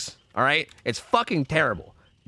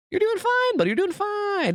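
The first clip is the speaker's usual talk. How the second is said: much higher, sing-song soothing; mock reassurance. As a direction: coo it like soothing a child.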